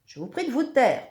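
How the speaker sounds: loud, high demand for silence; indignant monster boom; thundering. A narrator's reading. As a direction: thunder at them to shut up. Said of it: "tonne le monstre à tête d'hippopotame".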